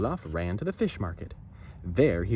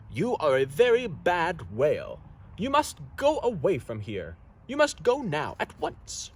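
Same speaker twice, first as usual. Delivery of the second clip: higher, scolding, ending in a hiss; very angry Noggin; 5 semitones higher than usual. Acted. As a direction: tell off the whale sternly. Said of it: "very, very angry".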